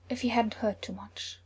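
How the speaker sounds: usual speaking voice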